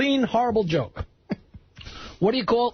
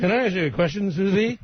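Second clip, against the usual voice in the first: [name] impression. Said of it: "[name] is on stage".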